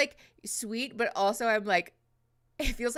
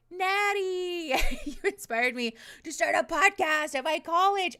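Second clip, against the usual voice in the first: excited; 9 semitones higher, 5 dB louder, slower than usual. Real, not acted. Reading thrilling chat message aloud.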